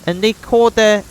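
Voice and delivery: high, tense voice of a wronged settler; grim villager. Acted. Voices Oleg describing Stag Lord.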